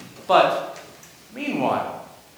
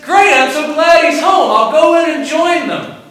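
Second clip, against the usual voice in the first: mock cheerful; 9 semitones higher, 8 dB louder than usual. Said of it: "Oh, wait, no".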